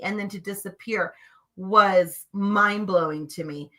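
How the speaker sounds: ordinary talk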